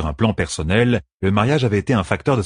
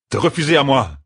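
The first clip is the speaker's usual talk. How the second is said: raised pitch, moderately angry outcry; furious exclamation; 7 semitones higher, 3 dB louder than usual. A narrator's reading. Officer protesting to his beloved queen, mildly furious cry. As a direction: exclaim it with a touch of fury. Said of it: "s'écria Talanvor, furieux".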